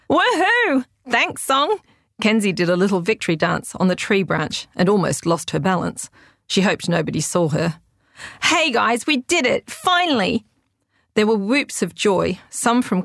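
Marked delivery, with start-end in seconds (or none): 0.1-1.8 high, bright whooping cheer; excited cheer. A narrator's reading. Girl cheering from tree. 8.4-10.4 high, jubilant shout-out; excited. A narrator's reading. Celebrating win over headset.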